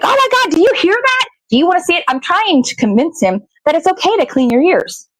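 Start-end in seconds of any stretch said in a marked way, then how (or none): 0.0-2.0 high, excited coaxing voice; mock thrilled pitch to a child. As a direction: sell it to him like a thrilling discovery. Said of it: "I'm like".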